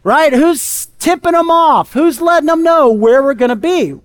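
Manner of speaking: demanding king. Acted. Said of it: "He's like".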